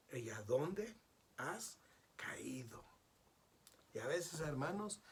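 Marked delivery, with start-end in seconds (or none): 0.1-2.8 whispered and slow. Real, not acted. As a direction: let it fall to a breath. Solemn question.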